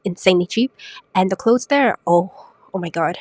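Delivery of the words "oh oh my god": usual speaking voice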